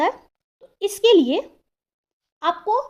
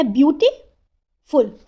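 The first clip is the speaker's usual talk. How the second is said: Beautiful slowly, gap before the final फुल; deliberate two-beat beautiful; broken into syllables; much slower than usual. Shown as a demonstration. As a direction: sound out beautiful in two pieces. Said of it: "हम बोल कैसे रहे हैं".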